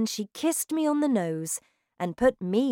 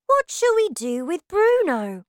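put-on high, bright young boy voice; child voice; 11 semitones higher, 8 dB louder than usual. A narrator's reading. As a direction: voice the little boy lightly. Child mouse voice.

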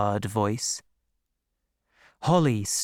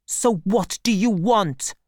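angry; 9 semitones higher, 3 dB louder than usual. A narrator's reading. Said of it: "asked Holly angrily".

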